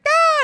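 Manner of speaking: high, loud yelp of fright; retold shriek as the guy lunges; mock startled shriek. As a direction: let out a jumpy little scream. Her own scream, retold.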